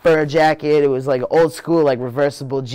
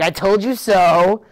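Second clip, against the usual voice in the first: high, slow, told-you-so mom voice; mother gloating at her son; smug mom; 8 semitones higher, 5 dB louder, slower than usual. Retold or imitated. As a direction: draw it out smugly like a gloating mother.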